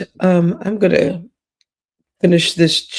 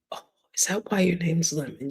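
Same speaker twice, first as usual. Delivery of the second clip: whisper; 10 dB quieter, faster than usual. Real, not acted. Aside joke about a lemon.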